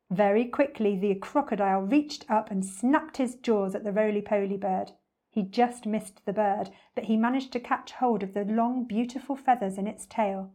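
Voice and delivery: plain reading voice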